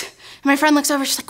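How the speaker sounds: normal talking voice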